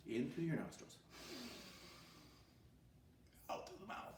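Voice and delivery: soft, breathed-out guidance; guided breathing. Shown as a demonstration. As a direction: breathe along as you say it. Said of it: "Let's try it together".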